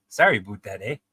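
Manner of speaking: Canadian accent with "about" as "aboot"; amateur Canadian impersonation. Shown as a demonstration. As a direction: try a stock Canadian apology. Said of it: "do a Canadian accent".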